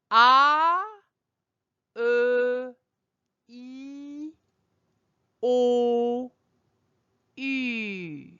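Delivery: "ah" loud and open, "O" rounded, "U" with pursed lips; French vowels ah, uh, e, o, u. Shown as a demonstration. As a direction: model the five vowels in a row for the class.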